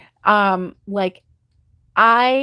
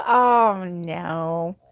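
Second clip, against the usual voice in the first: mock letdown; 4 semitones lower than usual. Host retelling her reaction to a dentist appointment, mock letdown. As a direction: let the excitement drop away into dismay.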